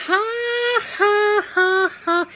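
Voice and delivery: high, ringing "ha, ha, ha, ha"; imitated big laugh. Woman who found everything funny, imitated high laugh. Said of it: "You think my laugh is funny".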